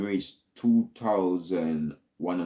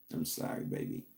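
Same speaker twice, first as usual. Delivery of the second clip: whispered mock apology; 12 dB quieter than usual. Mock apology to offended girls.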